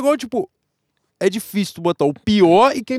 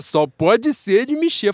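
slow, stretched-out guess; drawn-out; 3 semitones higher, much slower than usual. Retold or imitated. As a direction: drag the words out, unsure. Mimicking a hesitant guess.

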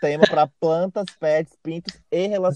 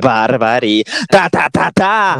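loud, sassy diva exclamation; campy drag queen; 8 dB louder, slower than usual. Acted.

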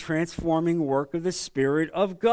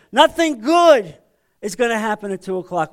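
much louder and higher stern warning; scolding parent; 4 semitones higher, 8 dB louder than usual. Retold or imitated. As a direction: bellow it like a parent at a late-coming kid. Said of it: "What would you say?".

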